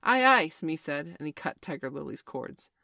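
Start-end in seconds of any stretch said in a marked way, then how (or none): 0.0-0.5 high, loud aye-aye; quick-to-obey deckhand's shout; obedient sailor's reply. A narrator's reading. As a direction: sing out the acknowledgement to your captain. Smee answers the captain's order.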